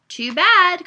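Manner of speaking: loud, high call. A narrator's reading. Man calling after Mariana as she's dragged off, calling out. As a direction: call it after someone walking off. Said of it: "called the man".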